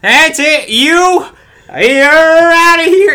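very loud, high, slow umpire bellow; umpire ejection call. Pretend. Fast food worker ejecting an arguing customer, umpire bellow. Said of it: "just look at him and go".

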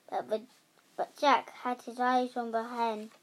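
ordinary delivery, nothing marked

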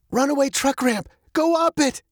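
urgent high-pitched call. A narrator's reading. Girl calls to her dad from the car, urgent high call. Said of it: "she called to her dad".

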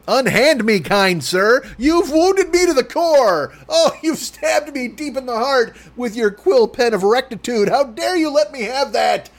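high, slow theatrical wounded cry; hammy stage actor. Pretend. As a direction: ham up a melodramatic victim. Mock wounded theatrical speech.

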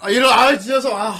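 loud, high cry of dismay; dismayed outburst. Acted. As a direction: groan loudly at an unwelcome spoiler. Dismayed at unwelcome sequel spoiler. (듣기 싫은 소식에 크게 탄식)

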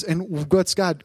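ordinary talk, nothing marked

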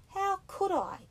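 high, loud indignant protest; indignant cry. A narrator's reading. Piggy protests to Ralph, high and indignant. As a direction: fling it back, wounded and outraged. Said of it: "cried Piggy indignantly".

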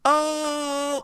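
high tossed-off oh; Mordecai's oh. Retold or imitated. Imitating the cartoon's catchphrase, high. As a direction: throw the head back on it. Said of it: "Where they go like".

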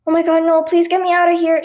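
high, loud panicked plea; starting to panic. A narrator's reading. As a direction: beg urgently to get out. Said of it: "she said, starting to panic".

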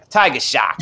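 punchy imitated Boston accent. Fan showing how the Jaws actor sounds, Boston accent. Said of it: "it sounds like a Boston accent".

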